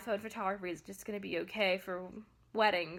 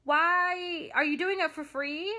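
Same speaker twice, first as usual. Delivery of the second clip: high, loud mock disbelief; imitating an incredulous customer; incredulous why; 7 semitones higher, 4 dB louder, slower than usual. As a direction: scoff at them in disbelief. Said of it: "And you're like".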